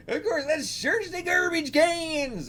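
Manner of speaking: put-on high, slow bumpkin accent bending "garbage"; country bumpkin accent. Beggar proposing a bin search, high country bumpkin accent. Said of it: "kinda like country bumpkin accents".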